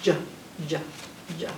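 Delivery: short, separate "J" sounds, "juh". Shown as a demonstration. Reader showing children the letter's sound, J sound. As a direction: say J as a clipped "juh", three times. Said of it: "the sound that J makes is".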